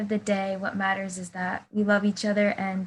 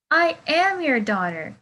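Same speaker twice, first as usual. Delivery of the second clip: insistent; 7 semitones higher, 4 dB louder than usual. Acted. Maxine insists to her mother.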